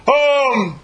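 loud, high holler across a gym; coach hollering. Acted. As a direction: bark it across the floor. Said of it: "coach hollers across the floor".

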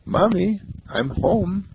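put-on high small child's voice; lost boy calling to his sobbing mother, high and small. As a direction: call out like a little boy home.

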